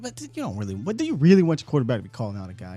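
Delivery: ordinary talk, nothing marked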